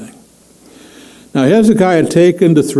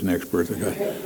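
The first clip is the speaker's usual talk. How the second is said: "Next birthday, I got thirteen" dropped much softer, fading out; fading to near silence; 7 semitones lower, 15 dB quieter than usual. Shown as a demonstration. Preacher jokes about counting down his years, fading softer. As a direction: let the count of years fade away. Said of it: "I don't know if I'd wanna know".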